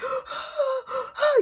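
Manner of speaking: high, gasping mock sobs; exaggerated crying gasps; imitated crying. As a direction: fake a child's gulping cry. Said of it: "he started crying like".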